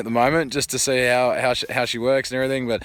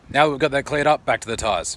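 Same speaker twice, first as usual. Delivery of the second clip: ordinary talk, nothing marked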